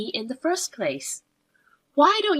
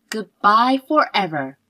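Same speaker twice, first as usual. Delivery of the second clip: usual speaking voice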